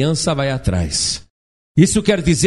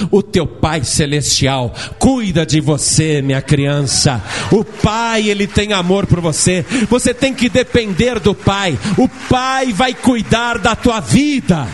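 fervent preaching; 3 semitones higher than usual. Acted. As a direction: preach it, building to a cry. Preaching climax to congregation.

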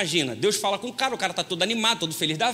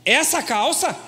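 lightly voiced skeptical wife, higher; wife disapproving; 5 semitones higher, slower than usual. Wife questioning husband's choice of pants, a bit higher. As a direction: question his pick like a doubting wife. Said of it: "ela tá aqui do lado".

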